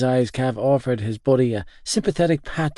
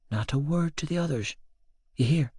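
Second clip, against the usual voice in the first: put-on soft, hushed old woman's voice; secretive warning; 3 semitones higher, 6 dB quieter than usual. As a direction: quietly swear him to secrecy. Nanny secretly warns him to silence.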